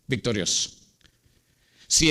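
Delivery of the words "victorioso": low soft echo. Shown as a demonstration. Preacher echoing victorioso to the congregation, low and soft. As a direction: repeat victorioso much lower and quieter. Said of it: "es victorioso".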